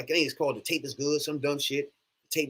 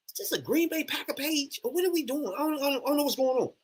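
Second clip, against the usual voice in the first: higher, puzzled put-on voice, stammering at the end; mock confusion; 10 semitones higher, 5 dB quieter than usual. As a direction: play it like you wandered onto the wrong fan page. Retold confused thought about fan pages.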